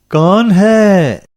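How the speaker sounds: loud, high, long-drawn challenge; calling from inside. A narrator's reading. Old woman answering a knock at night, drawn-out call. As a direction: stretch it out high, calling to a stranger.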